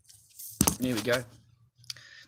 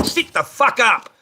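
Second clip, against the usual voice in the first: sharp yell; 8 semitones higher, 8 dB louder, slower than usual. Real, not acted. Sudden yell at someone off-screen.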